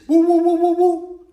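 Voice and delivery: high, loud sung woo-woo whoop; hammed-up whooping chant; mock war whoop. Joking teller mimics a war cry, loud high whoop.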